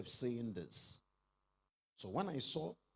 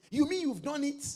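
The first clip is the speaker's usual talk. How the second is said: amazed; 14 semitones higher, 9 dB louder than usual. Retold or imitated. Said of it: "look at our life and say".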